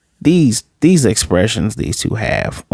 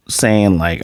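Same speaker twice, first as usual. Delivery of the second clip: ordinary talk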